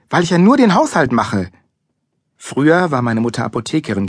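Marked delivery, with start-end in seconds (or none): 0.1-1.5 high, loud, sarcastic retort; indignant snapping. A narrator's reading. Mother about her housework, snapping. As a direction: throw it back bitterly. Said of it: "fauchte Mama".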